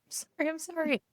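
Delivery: high, hurried string of sorries; high-pitched, frantic mock apology; profusely apologizing kid. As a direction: imitate a small boy apologizing over and over. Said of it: "apologizing profusely, following him, going".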